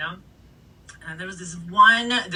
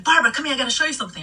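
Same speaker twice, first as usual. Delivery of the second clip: higher and louder, pulling her along; eager urgent call; 8 semitones higher, 4 dB louder, much faster than usual. Retold or imitated. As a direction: insist excitedly. Girlfriend grabbing her hand.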